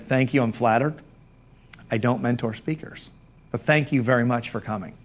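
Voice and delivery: soft, low polite brush-off; hushed, low-key thanks but no; polite refusal. Retold or imitated. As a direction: decline quietly and courteously. Mentor politely declines eager admirer.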